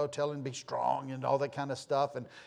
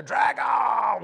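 put-on high shouted child's cry like Rocky on the mountaintop; mock triumphant shout of a little boy; Rocky battle cry; 10 semitones higher, 7 dB louder than usual. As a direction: throw it out like a boy playing Rocky. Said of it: "he'd throw his hands in there".

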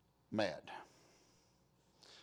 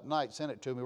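ordinary talk, nothing marked

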